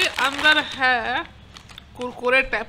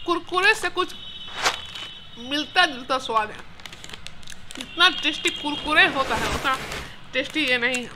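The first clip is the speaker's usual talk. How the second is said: speaking between bites; chewing while talking. Real, not acted. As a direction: mouth partly full. Seen 0: Lips press and work between words after eating snack earlier.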